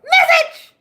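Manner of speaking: sudden high shout of message; loud call. Real, not acted. Ranting to her followers, a loud sudden call. Spiritual rant to viewers.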